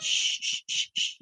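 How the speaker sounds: soft unvoiced shushing. Real, not acted. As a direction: hush her to sleep. Hushing the crying baby.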